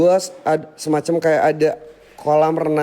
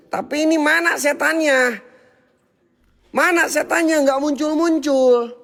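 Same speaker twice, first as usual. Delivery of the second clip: taunting call; 7 semitones higher than usual. Real, not acted. Taunting the absent ghosts.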